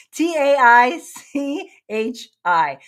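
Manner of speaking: slow, loud spelling "T A I C H I"; spelled out letter by letter. Shown as a demonstration. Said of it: "Tai Chi".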